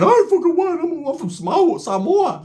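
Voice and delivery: high indignant protest, pitched well up; offended big Samoan. Retold or imitated. Big Samoan inmate protesting he's not Hawaiian. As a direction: squeak out the protest like he's insulted.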